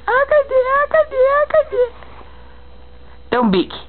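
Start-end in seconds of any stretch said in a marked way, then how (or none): 0.1-1.9 very high peekaboo coo. Real, not acted.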